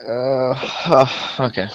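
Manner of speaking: ordinary talk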